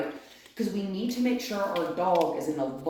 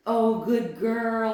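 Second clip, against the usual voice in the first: dog praise; 4 semitones higher, 6 dB louder, much slower than usual. Real, not acted.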